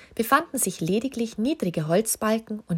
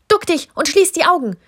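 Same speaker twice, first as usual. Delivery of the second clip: much higher and louder urgent call; excited shout; 11 semitones higher, 9 dB louder than usual. A narrator's reading. The king yells an order to his small companion. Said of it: "rief der König ... aufgeregt zu".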